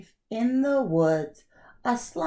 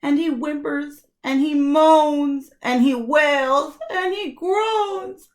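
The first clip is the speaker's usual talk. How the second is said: louder wailing, cry in the voice; mock blubbering; 6 semitones higher, 5 dB louder than usual. A narrator's reading. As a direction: narrate it as if the bear is sobbing. Bear wails and cries.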